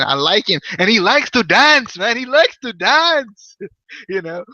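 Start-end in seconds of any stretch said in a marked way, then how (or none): 0.8-2.2 high, slow mock Indian accent; terrible accent. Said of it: "quit with this terrible accent". 2.2-3.6 high, drawn-out mock Indian accent; terrible accent. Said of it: "quit with this terrible accent".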